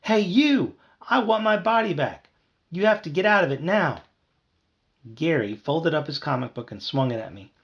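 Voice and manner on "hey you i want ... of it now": high, loud and insistent; raised, indignant yell; angry demand. A narrator's reading. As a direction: demand it furiously in a little voice. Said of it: "I buzzed angrily".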